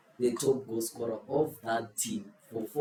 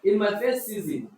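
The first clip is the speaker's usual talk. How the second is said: higher and louder, calling out the next round; loud announcing; 8 semitones higher, 6 dB louder than usual. Real, not acted. Quizmaster loudly announcing the second question to players. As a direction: call out the question like a host.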